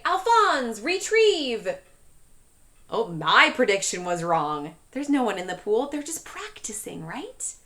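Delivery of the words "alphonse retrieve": command. Acted. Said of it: "Lewis would say".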